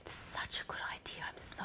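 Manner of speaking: whispered, drained mock relief; tired relieved whisper. Tired pregnant patient welcoming her partner coming, relieved whisper. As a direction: breathe it out in a grateful whisper.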